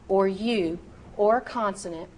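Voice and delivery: ordinary delivery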